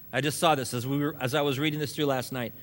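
ordinary talk

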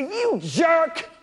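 high, shouted mock insult; a hammed-up rude retort, high and loud. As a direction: sneer at the king, then slow. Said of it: "they don't look at the king and say".

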